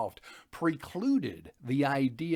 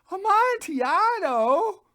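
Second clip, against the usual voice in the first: much higher, louder, slow drawn-out question; can't contain the greed for wine; squealing, stretched-out Amontillado; eager cry; 11 dB louder, slower than usual. Acted. Fortunato's eager repeated question.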